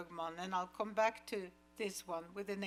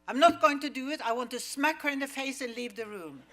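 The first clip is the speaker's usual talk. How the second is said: loud, high, half laughing outrage; mock furious outburst; 4 semitones higher, 11 dB louder, faster than usual. Actress refusing the director, loud and outraged. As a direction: blurt it out as the offended star. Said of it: "She says".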